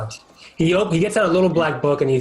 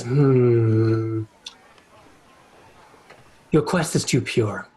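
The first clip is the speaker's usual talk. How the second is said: lower, slow dismissive devil voice; a sly fiend sizing up the hero; devil dealer; 6 semitones lower, much slower than usual. Acted. Devil dealer turning down Sovereignty, lower and dismissive. GM voicing the deal-making devil.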